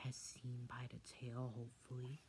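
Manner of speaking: soft whispered aside; hushed aside. Real, not acted. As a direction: drop to a whisper for the joke. Aside in narration.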